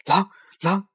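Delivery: short, high, loud yelps of alarm; terrified little rabbit calling out; frightened cry. A narrator's reading. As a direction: scream the warning in fright. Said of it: "小兔子害怕地叫了起来".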